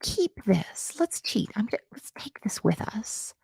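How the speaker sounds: sneaky whisper. Acted. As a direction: whisper it like a cheat. Whispered cheat aside.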